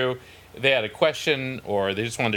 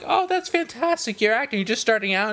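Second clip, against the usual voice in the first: much higher, sing-song patronizing praise; mock condescension; 10 semitones higher than usual. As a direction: pat her on the head with your voice. Patronizing the unknown actress.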